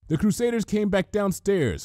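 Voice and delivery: ordinary delivery, nothing marked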